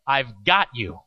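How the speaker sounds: low-pitched, unhurried promise, not hollered; quiet reassurance. Retold or imitated. Camp leader answers looking right at scared boy, quietly reassuring. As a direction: imitate a leader steadying one scared kid. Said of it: "instead of hollering to Hank".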